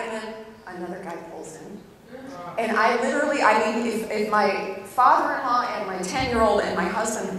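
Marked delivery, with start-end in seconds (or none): none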